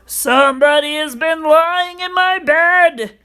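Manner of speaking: loud, high-pitched gruff bear voice; great huge bear. A narrator's reading. Big bear finds his porridge touched, loud gruff voice. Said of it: "in his great rough, gruff voice".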